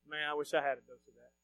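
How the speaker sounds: low, very soft aside; secret wish. Acted. Listener privately envying a fired-up preacher, hushed secret wish. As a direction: say it under your voice, as if to yourself. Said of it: "you're not gonna tell anybody".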